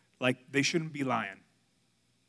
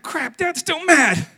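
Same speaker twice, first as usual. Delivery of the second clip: high, loud, drawn-out dismay; mock alarmed kids; 12 semitones higher, 13 dB louder, slower than usual. Kids reacting to dad's summons, mock alarm. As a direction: play the kids dreading dad, hammed up. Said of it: "They're like".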